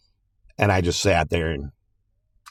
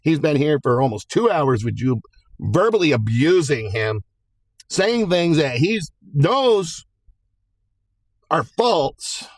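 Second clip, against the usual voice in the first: higher, measured stern judge; imitating the judge; 6 semitones higher than usual. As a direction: judge scolding the lawyers, lightly voiced. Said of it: "She's like".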